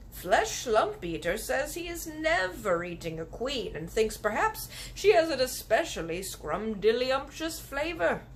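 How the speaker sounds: the kindly giant relishing a strange word. Acted. BFG voiced in story.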